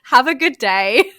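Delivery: slow, falsely sweet sign-off; slow, falsely sweet goodbye; sarcastic farewell. Retold or imitated. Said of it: "this new Dana".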